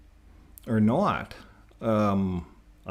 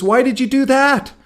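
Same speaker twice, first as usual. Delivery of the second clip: much higher, loud shocked cry; dismayed frog; 14 semitones higher, 8 dB louder than usual. Acted. Drowning frog to the scorpion, shocked and high. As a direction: cry out in betrayed disbelief, high.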